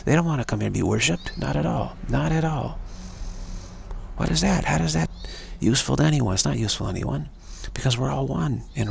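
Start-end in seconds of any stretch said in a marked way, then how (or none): 1.4-6.3 high emphatic. Real, not acted. Rising emphatic rhetorical.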